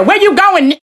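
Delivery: high mock yell of the angry woman; imitating angry yell. Narrator mimicking the angry date's yell, high mock shout. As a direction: throw in her shout as a warning. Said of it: "Anytime you hear".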